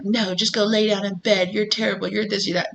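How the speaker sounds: a sly discouraging voice in your head; negative inner voice. Retold or imitated. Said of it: "negative inner voice that's like".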